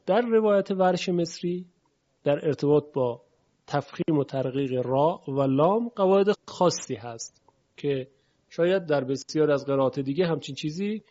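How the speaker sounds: usual speaking voice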